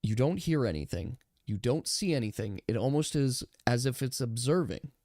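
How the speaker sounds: eerie, quiet game master narration; hushed suspense narration. A narrator's reading. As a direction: draw them in with a hushed voice. GM narrates eerie silent moment.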